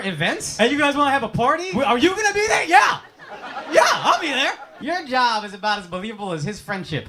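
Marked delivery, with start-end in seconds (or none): 0.6-4.5 high, eager mock pitch; put-on upbeat invitation; peppy party promoter. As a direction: chirp it like an over-keen event planner. Acting out event marketing pitch.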